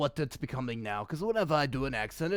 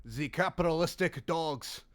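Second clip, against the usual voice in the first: put-on Russian accent. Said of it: "Russian accents are actually, like, good".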